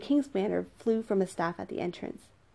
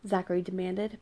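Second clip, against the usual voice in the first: ordinary delivery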